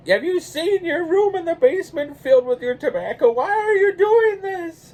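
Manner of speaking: very high, loud, scolding wife voice; mock wife's fit. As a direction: shriek like the exasperated wife. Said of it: "my wife's like".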